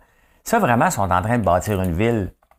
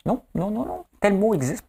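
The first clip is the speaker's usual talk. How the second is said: much higher, insistent mock protest; contestant arguing; 12 semitones higher than usual. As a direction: play the contestant swearing the word exists. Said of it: "Ils s'astinent des fois".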